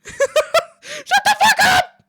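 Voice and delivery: very high, drawn-out scream, laughing; screamed outburst. Real, not acted. Yelling back at the church complaint, exasperated scream. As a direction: scream it at full volume.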